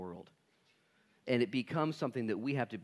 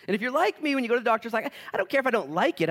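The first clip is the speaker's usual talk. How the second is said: high, loud preacher's cry; fired-up sermon delivery; preaching at full force; 11 semitones higher, 8 dB louder, faster than usual. Acted. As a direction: drive it home to the congregation.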